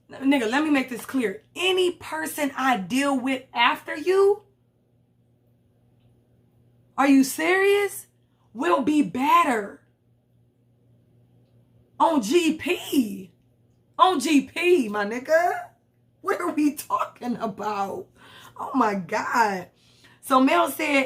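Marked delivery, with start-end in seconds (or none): 1.6-15.7 slow, drawn-out disbelief; slow incredulous rant at the ex; exasperated rant. Real, not acted. Ranting at ex in disbelief. 16.2-19.7 slow, low, worn-out sigh of words; slow exasperated muttering; weary disbelief. Real, not acted. Reacting in disbelief.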